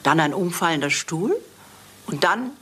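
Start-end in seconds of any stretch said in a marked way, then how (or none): none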